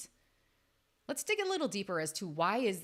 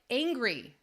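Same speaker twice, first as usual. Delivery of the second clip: usual speaking voice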